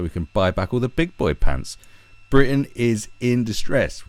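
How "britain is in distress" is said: slow, louder grave proclamation; mock solemn announcer. Announcing the loss of big boy pants, slow mock-solemn proclamation. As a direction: declare it like a national emergency.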